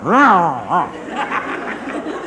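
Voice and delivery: high drawn-out mock roar; imitated stinger back. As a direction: roar back wordlessly, hammed up. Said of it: "and I went".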